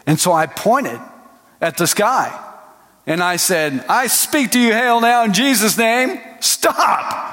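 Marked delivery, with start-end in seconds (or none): 3.9-7.2 high, forceful command to the storm; commanding rebuke. Retold or imitated. Preacher pointing at the sky commanding the hail, forceful. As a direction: order the hail to stop.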